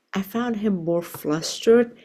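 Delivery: normal talking voice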